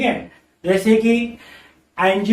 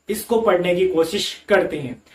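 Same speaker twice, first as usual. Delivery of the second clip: usual speaking voice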